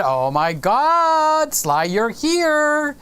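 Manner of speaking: slow, stretched delighted greeting; mock excitement. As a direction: act out a friend's thrilled reaction. Reads Lewis greeting his friend.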